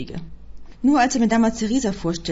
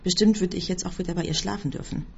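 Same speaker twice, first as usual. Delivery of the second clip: ordinary delivery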